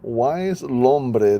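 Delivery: ordinary delivery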